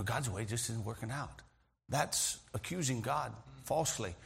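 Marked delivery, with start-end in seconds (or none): 0.0-1.4 muttered complaint, soft and low; quiet grumble. Retold or imitated. Grumbling quietly to a friend, mildly soft. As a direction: grumble it under your breath. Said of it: "rather than me telling Mark".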